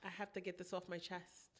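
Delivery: quiet, mumbled mock British accent; lightly faked British accent; mock fake British accent. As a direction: put on a bad British accent. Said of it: "I don't know what accent that was".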